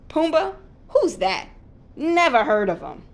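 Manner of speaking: loud, mock-puzzled Pumbaa? then scoffing; sarcastic dismissal. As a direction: feign forgetting him. Timon pretends to forget Pumbaa.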